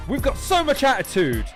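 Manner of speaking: loud, high hype call; hype announcer. Real, not acted. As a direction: pump up the crowd. Hyping the crowd before battle.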